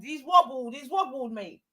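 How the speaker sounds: normal talking voice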